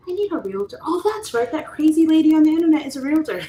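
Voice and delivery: high, loud sudden recognition; suddenly remember the crazy lady; excited realization. Retold or imitated. Imagined client remembering her.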